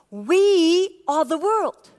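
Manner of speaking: very slow, every word weighted and loud; slow emphatic declaration. Shown as a demonstration. Pastor telling the congregation everyone belongs, slow and loud. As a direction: spell out each word as a rallying claim. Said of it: "Every single one of us".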